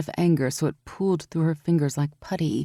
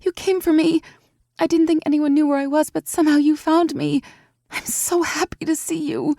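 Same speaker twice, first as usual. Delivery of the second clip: blurted joy; 12 semitones higher than usual. A narrator's reading. Evie blurting relief to her rescuer, high excited rush. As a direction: burst it out all at once, overjoyed. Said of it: "she blurted out".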